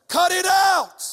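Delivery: sudden high, loud shout; shouted command. Acted. Preacher quoting Jesus against sin, sudden shout. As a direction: bark it like an order.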